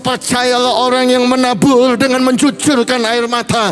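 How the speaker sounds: fervent preaching. Acted. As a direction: preach it with growing fire to the congregation. Preacher builds to altar call. (khotbah berapi-api yang makin keras)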